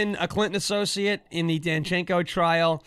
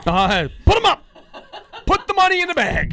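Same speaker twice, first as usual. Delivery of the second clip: high, loud hammed-up stick-up voice; robber voice; 12 semitones higher, 6 dB louder than usual. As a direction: play a cartoon bank robber. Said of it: "I'm like Kathy Hochul right now".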